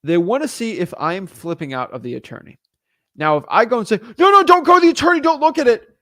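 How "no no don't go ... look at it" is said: high, loud, rushed pleading no no; panicky scammer. Retold or imitated. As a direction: blurt it out like a nervous scammer. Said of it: "it sounds suspicious".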